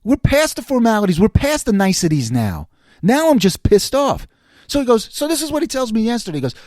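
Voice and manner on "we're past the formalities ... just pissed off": irritated retort. Retold or imitated. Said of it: "I'm like".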